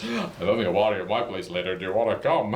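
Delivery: a hint of a laid-back alpaca. Alpaca inviting the storyteller to a party, lightly voiced.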